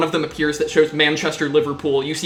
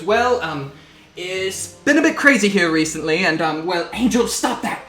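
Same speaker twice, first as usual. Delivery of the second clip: flustered officer, raised pitch, stumbling through excuses; Captain Lawrence, harried; 4 semitones higher, 3 dB louder than usual. Acted. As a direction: play a rattled captain juggling chaos mid-call. Game master plays harried Captain.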